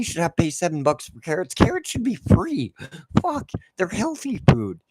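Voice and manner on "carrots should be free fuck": indignant squeak. Real, not acted. Ranting about food prices.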